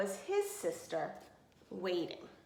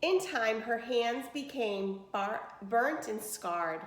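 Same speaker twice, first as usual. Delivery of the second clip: ordinary delivery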